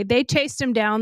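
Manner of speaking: plain reading voice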